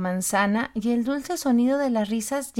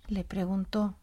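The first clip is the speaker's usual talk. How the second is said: usual speaking voice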